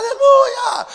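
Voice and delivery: very high, loud praise shout; congregation yelling. Retold or imitated. Church crowd as the lights go out, mock yelling. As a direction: imitate a crowd screaming in the dark. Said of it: "começa a gritar mais alto".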